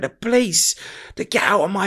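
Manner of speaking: high, frantic imitation of mum; high-pitched retold outburst; retold screaming. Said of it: "she's screaming, screaming".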